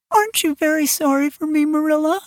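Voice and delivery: high, plaintive little plea. A narrator's reading. Injured Anne in bed asking Marilla for pity, plaintive and high. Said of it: "a plaintive voice".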